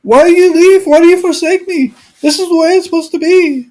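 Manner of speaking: high, pleading father's voice. Retold or imitated. Father begging his angry older son to come home, high and pleading. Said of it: "He said, pleaded".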